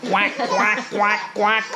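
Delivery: quacking like a duck; playful duck impression; duck quacks. Shown as a demonstration. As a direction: quack it out for the child. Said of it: "What does a duck say?".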